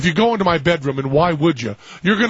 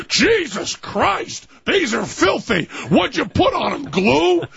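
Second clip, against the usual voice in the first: higher, slow disgusted exclamation; mock-disgusted stranger, pitched up; 7 semitones higher, slower than usual. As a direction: recoil at how dirty they are.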